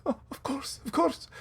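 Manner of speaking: very high, eager-to-obey voice; servile merchant. Acted. Merchant yielding to the captain's authority, servile. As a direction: agree meekly in a high, small voice. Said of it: "he's immediately just servant to Lucanu".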